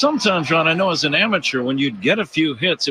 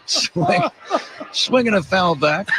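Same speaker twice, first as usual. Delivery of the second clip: laughing call; 5 semitones higher than usual. Real, not acted. Laughing while calling a swing.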